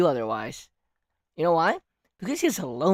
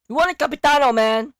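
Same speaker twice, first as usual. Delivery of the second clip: loud complaint; 7 semitones higher, 7 dB louder than usual. Real, not acted. Complaining loudly.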